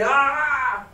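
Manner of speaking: high sharp pained cry. A narrator's reading. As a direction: yelp as if cut. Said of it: "a cry of pain".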